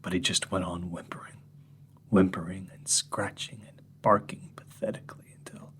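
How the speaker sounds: soft whispered account of the dog; softly recounting the dog's end; hushed grim telling. Acted. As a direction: let it fade to a whisper.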